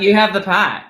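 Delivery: casting people mimicked in Aussie; Aussie accent. Casting people handing over the part, mock Aussie accent. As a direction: put on an Australian accent. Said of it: "I just go into auditions with this accent".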